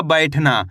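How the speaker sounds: 'बैठना' को अक्षर जोड़कर एक शब्द में बोलें; 'बैठना' में खुला 'ऐ', अंत में सुर गिराएँ; मिलाकर पढ़ना. Shown as a demonstration. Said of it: "तो मिलके बन जाएगा". ('बैठना' साफ़, जुड़ा हुआ उच्चारण)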